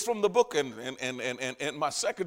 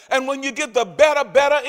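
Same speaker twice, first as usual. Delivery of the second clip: second better given extra weight; emphasis on better; 7 semitones higher, 11 dB louder than usual. Shown as a demonstration. Preacher stressing better, better for the congregation, emphatic. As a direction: lean hard on the repeated better. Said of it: "It is just an emphasis on the better".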